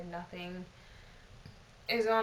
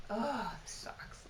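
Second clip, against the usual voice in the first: slow, soft whispered moan of disappointment; whispered groan; 3 dB quieter, slower than usual. Retold or imitated. Lamenting the lost opportunity to herself, slow whisper. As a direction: sigh the words out in a whisper. Retold inner grumble, disappointed.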